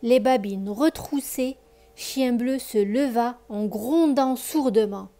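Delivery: put-on lively read-aloud voice for children; expressive bedtime story reading; story narration. A narrator's reading. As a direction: tell the tale warmly to a child listener.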